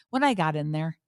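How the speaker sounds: ordinary delivery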